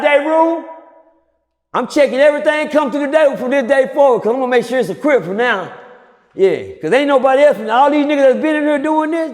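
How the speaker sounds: high, loud laying down the law; retold shouted decree to the day room; loud proclamation. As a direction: announce it to the whole room. Laying down a new rule to the unit.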